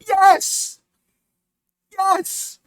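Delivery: high-pitched emphatic cry; incredulous high yelp; exasperated yes. Real, not acted. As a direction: squeal the answer in exasperation. Exasperated answer to quoted question.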